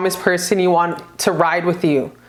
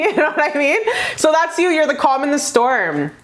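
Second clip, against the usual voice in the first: ordinary delivery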